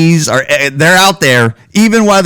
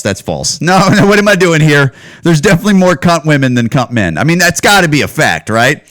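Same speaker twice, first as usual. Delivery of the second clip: ordinary talk, nothing marked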